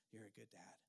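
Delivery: a father softly blessing his son; tender hushed words. Retold or imitated. Father hugging son, retold.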